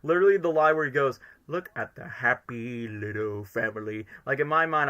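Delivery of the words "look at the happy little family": low, slow villain mockery; understated villain sing-song; Eggman. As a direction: draw it out low and mocking. Imitates Eggman's sneering film line.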